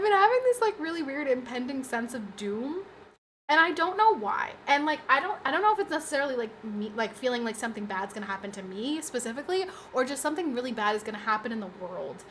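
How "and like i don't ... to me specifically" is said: usual speaking voice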